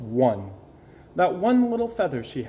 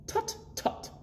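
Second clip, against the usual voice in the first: doctor tutting; 10 dB quieter than usual. A narrator's reading. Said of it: "said the doctor".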